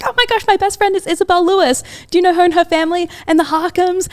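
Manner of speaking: mock-gush about a mutual best friend; excited disbelief. Telling a friend about meeting Ali, excited retold gushing.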